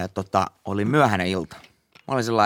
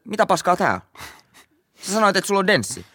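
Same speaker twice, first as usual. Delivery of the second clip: indignant disbelief; 10 semitones higher than usual. Retold or imitated. Confronting dealer, laughing.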